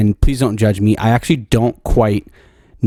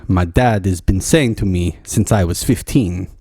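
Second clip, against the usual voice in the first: usual speaking voice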